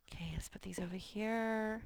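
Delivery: unvoiced aside to herself; whisper. Real, not acted. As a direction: whisper it while tidying cards.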